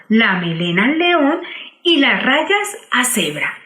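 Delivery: plain reading voice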